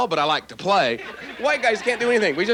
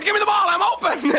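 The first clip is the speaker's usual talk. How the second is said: mock shouting player; 12 semitones higher than usual. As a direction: call for the pass, laughing. Player begging for the ball.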